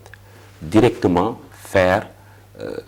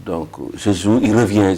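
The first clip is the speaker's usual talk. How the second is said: ordinary talk, nothing marked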